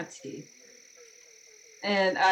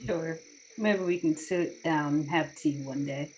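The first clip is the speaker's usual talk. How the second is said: quiet, deadpan sarcastic agreement; mildly sarcastic invitation; understated sarcasm; 4 semitones lower, 7 dB quieter than usual. Acted. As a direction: agree without meaning it, lightly. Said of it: "That was sarcasm".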